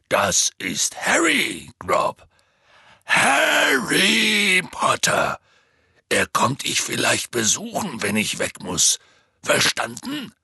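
loud, slow introduction, stretching the name; Hagrid. A narrator's reading. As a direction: teach a giant a name at full volume. Hagrid introduces Harry to slow giant. (laut und langsam vorstellend)

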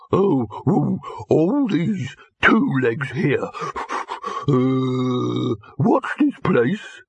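a handsome dog with nothing upstairs; dim slow dog. A narrator's reading. Dim dog speaks again.

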